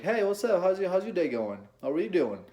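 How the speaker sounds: high, loud, over-eager greeting; non-seductive voice. Shown as a demonstration. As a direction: pitch it up high and push the volume. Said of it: "a non-seductive voice sounds like".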